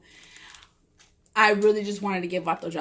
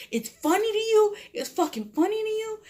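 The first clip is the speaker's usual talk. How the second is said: high-pitched mocking imitation; incredulous questions pitched way up; offended challenge; 12 semitones higher than usual. Jealous Vato confronts her for laughing, imitated indignant squeal.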